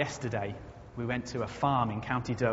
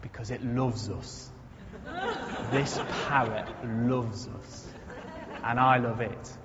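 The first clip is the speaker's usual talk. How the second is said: put-on slow, drawn-out, low County Durham accent; broad North East English, low and unhurried, County Durham; 3 semitones lower, slower than usual. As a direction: imitate a broad Durham farmer, words stretched out slowly. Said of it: "in his broad County Durham accent".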